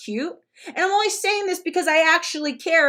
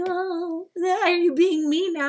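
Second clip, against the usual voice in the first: mock victim; 4 semitones higher, slower than usual. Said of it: "Don't act like".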